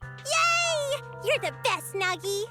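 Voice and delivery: put-on very high-pitched, childlike delight; overjoyed high little voice; gleeful cheer. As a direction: squeal thanks in high glee. Delighted at getting extra snacks.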